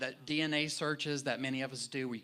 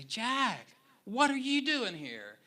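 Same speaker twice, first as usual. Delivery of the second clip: high, louder surprised call across the room; surprised friend calling out; 9 semitones higher, 4 dB louder, slower than usual. Retold or imitated. Old friend Mike surprising the pastor in the sanctuary, surprised call. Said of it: "I hear this voice say".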